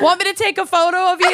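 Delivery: fast chirpy stranger voice; overly friendly local; friendly Midwesterner. Pretend. Imitating Midwestern stranger, laughing.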